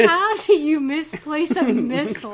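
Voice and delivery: loud laughing disbelief; incredulous. Real, not acted. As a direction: ask it in shocked amusement.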